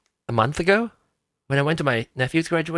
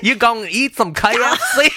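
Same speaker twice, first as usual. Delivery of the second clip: higher, laughing country-accent put-on; 6 semitones higher than usual. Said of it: "When you do the accent".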